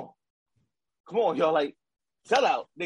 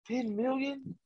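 1 hushed disbelief; 4 semitones higher, 9 dB quieter, much slower than usual. Real, not acted. Repeating in disbelief.

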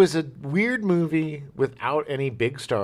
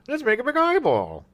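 dopey excited guy; 12 semitones higher, 4 dB louder than usual. Retold or imitated. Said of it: "and was like".

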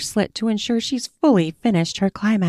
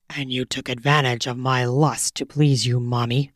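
dominant lover; 6 semitones lower than usual. A narrator's reading. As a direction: growl the order low and close. Male lover voiced low.

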